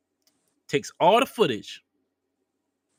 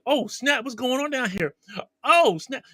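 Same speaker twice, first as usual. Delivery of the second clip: mock guards, pitched much higher; 9 semitones higher than usual. Guards stumbling on the scene, high mock surprise. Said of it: "the other guards come down like".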